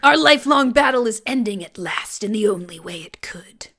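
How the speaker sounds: hushed menacing whisper; whispered villain line. Retold or imitated. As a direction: breathe it like a sinister villain. Quoting Skeletor, whispered.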